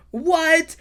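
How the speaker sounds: sudden high, loud disbelief; a startled cry of surprise; shocked what. Retold or imitated. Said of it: "everybody's like".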